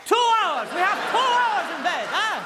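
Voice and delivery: high, loud, gleeful outburst; over-the-top thrilled yelling; excited. Acted. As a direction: shout it high with mounting excitement. Acted excited plea for bed.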